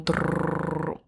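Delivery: trilled drum-roll noise; imitated drum roll. Shown as a demonstration. Host building to the name reveal, trilled drum roll. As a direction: roll the tongue like a snare. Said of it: "Drum roll".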